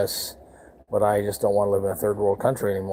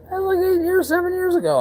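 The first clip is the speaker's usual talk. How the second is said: incredulous; 3 dB louder than usual. Real, not acted. Sarcastic aside on old robbery.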